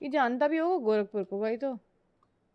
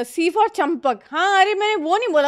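excited protest; 7 semitones higher, 10 dB louder than usual. Real, not acted. Caught cheating at word game.

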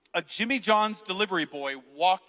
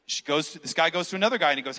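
ordinary delivery

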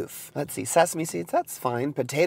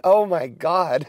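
shocked; 4 semitones higher, 8 dB louder, much slower than usual. Real, not acted. Reacting to fat content.

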